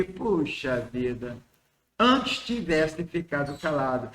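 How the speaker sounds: mild regret. Acted. Someone regretting hurtful written words, quietly rueful. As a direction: sigh it out with understated dismay. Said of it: "causando tristeza, constrangimento".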